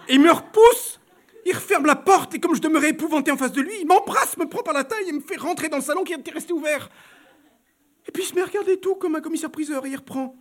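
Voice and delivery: high, loud, breathless telling; agitated retelling. Acted. Baroness confiding her fright to her friend, high and loud. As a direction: rush it out in alarm.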